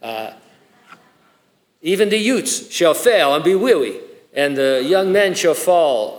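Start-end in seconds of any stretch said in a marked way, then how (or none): 1.8-6.2 put-on loud, high Italian accent slipping into baby talk; hammy Italian-American accent gone babyish; botched Italian accent. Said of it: "I was doing an Italian accent, it turned into a baby".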